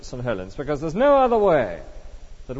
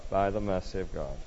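much lower and softer; hushed repeat; 6 semitones lower, 9 dB quieter than usual. Real, not acted. Preacher echoes the listener's answer to himself, hushed. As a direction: drop to a low, reflective hush.

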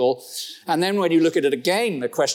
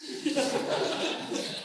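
breathy laugh; 13 semitones higher, 9 dB quieter than usual. Real, not acted. Laughs at own joke.

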